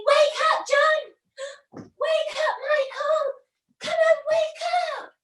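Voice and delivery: high excited calls to wake brothers; calling out to wake. Pretend. Wendy waking her brothers for Neverland, high excited calls. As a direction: call them awake, high and urgent.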